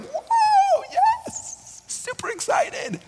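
squealing high-pitched mock excitement; over-the-top cheering, pitched very high; hyped crowd. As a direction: play the thrilled crowd, way up high. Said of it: "everyone's like".

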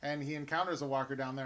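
normal talking voice

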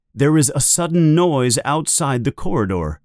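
ordinary delivery

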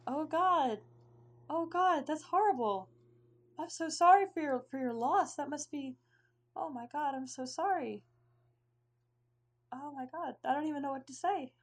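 high, stricken sympathy; shocked condolence. Acted. Host hears of a death.

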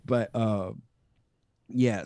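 normal talking voice

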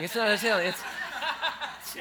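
laughing at own joke. Real, not acted.

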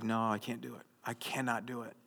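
ordinary delivery

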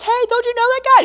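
put-on high, bright girl's question; little girl voice. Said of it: "It was to a little girl".

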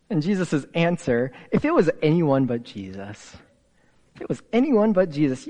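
usual speaking voice

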